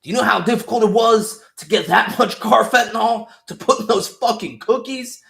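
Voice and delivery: high, loud, outraged agent voice; mock incredulous rage. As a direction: fume over a wasted plan. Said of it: "that guy's like".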